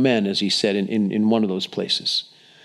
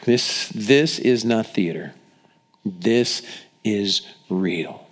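normal talking voice

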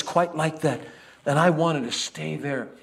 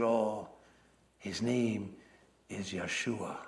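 tender; 6 semitones lower, 11 dB quieter, slower than usual. Acted. Miriam tenderly names her baby.

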